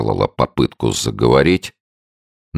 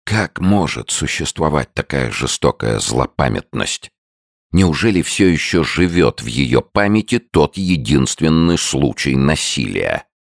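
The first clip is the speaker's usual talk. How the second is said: usual speaking voice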